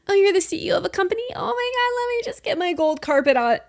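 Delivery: high, gushing sarcastic fan voice; sarcastic starstruck squeal; mock fawning. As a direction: gush over the CEO mockingly. Mocking fawning over a CEO.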